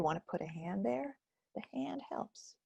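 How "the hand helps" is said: soft whisper. Shown as a demonstration.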